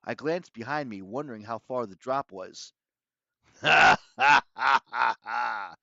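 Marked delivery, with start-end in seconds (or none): 3.6-5.7 loud, spaced-out villain "ha, ha"; villain's mock laugh. A narrator's reading. Doctor Thorn laughing at the cornered kids, villainous laugh. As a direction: laugh loudly, beat by beat, as a villain. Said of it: "Doctor Thorne laughed".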